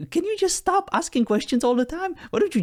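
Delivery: high, exasperated plea to just stop; fed up, begging it to quit asking; exasperated outburst. Real, not acted. User fed up with the AI's questions, exasperated high outburst.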